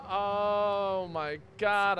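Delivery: very slow, high oh my God; drawn-out disbelief. Real, not acted. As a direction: stretch it out in disbelief. Reacts in disbelief.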